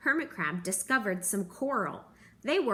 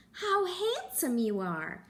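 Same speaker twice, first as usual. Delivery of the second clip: high, slow admiring praise; admiring crab; 7 semitones higher, slower than usual. A narrator's reading. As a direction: praise the starfish sweetly. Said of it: "said the Hermit Crab".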